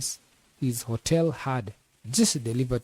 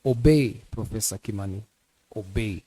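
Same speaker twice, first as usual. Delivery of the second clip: stern command; 3 semitones lower than usual. A narrator's reading. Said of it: "it gave the rest of the demand".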